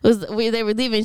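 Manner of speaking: ordinary talk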